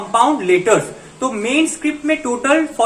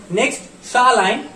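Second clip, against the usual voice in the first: ordinary talk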